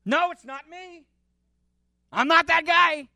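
high, anxious denial as Peter; panicked denial. Acted. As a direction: protest too much, pitched high. Peter denies being disciple.

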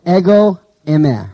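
"Ego eime" said slowly, each syllable apart; Greek phrase. Shown as a demonstration. Preacher reading "Ego eime" aloud to the class, slowly. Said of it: "So I am the door".

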